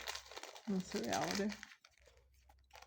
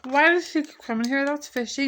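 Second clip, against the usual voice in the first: louder, higher, fed-up retort; exasperated outburst; 4 semitones higher, 5 dB louder than usual. Retold or imitated. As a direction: blurt it out, annoyed and suspicious. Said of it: "And I'm like".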